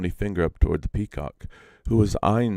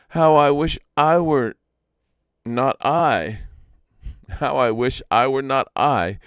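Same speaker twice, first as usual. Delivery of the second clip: higher, louder yearning spider imp; longing Iktomi; 4 semitones higher, 5 dB louder than usual. Acted. Iktomi wishes aloud to the peacock, higher and yearning. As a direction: plead for the bird's beauty, sighing and wistful. Iktomi voiced wishing for feathers.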